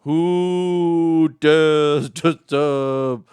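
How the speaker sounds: booming, slow, high ghostly rumble; loud, drawn-out menacing spirit; angry ghost voice. Acted. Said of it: "he started out seeming angry".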